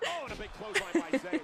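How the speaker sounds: high, loud play-by-play burst; excited call. Real, not acted. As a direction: call the big hit. Commentator calls a big move.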